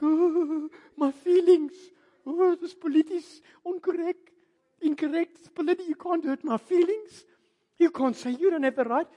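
high, loud mock whine of hurt feelings; whiny offended voice. Mocking the touchy congregant, loud and high whining. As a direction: ham up a touchy churchgoer crying about feelings.

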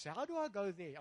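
ordinary talk, nothing marked

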